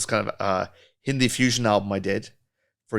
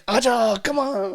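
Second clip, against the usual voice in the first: welcoming singer; 12 semitones higher, slower than usual. Retold or imitated. Imitating singer welcoming a new guest, high and friendly. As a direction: beckon a guest in like family. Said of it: "he's just so like".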